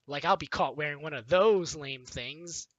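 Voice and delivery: normal talking voice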